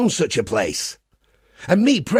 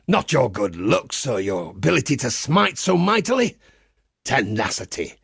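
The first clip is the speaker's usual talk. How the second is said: ordinary talk, nothing marked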